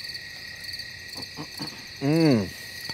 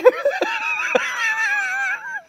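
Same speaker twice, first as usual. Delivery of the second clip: high laugh; 7 dB quieter than usual. Real, not acted. Laughing after rant.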